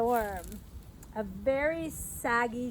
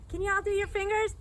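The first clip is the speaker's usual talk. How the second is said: usual speaking voice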